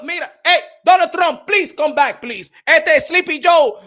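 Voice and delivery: half-joking loud appeal to Donald Trump; calling out. Real, not acted. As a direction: shout the plea to come back. Pleading to Trump.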